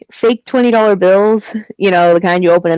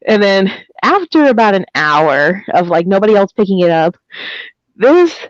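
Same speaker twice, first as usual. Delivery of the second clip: normal talking voice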